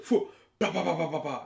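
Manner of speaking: imitated tumble noises. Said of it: "She said".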